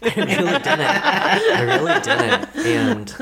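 laughing through the words, slowly; can't stop giggling while admitting it; real laughter breaking into the repeat. Real, not acted. Laughing while admitting it didn't work.

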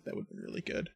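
whispered. Real, not acted. Wistful aside.

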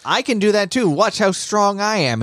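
high, eager mock bragging; Inosuke's boasting. Inosuke boasts, copying Tanjiro's trick.

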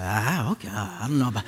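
low, reluctant mumble; doubtful. Acted. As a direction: act out dubious acceptance. Acts out doubt at therapist's advice.

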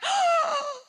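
high, loud cry of dismay; the big one just got away; dismayed shout. Retold or imitated.

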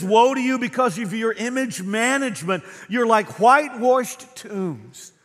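loud, high, driving sermon delivery; preaching at full force. Acted. Pastor to congregation, voicing Jesus' woes, preaching at full force.

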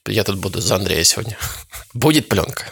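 ordinary talk

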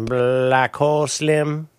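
very slow, stretched-out character voice; drawn-out robot impression. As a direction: stretch each word out slowly.

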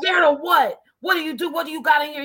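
loud, high, demanding police officer voice; imitating an angry officer shouting; aggressive cop. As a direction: bark the questions like a suspicious cop.

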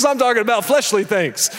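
ordinary delivery

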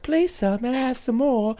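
soft British orphan plea; quiet, timid mock British accent; Oliver Twist. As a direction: beg meekly in a British waif voice. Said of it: "doing the other voice too".

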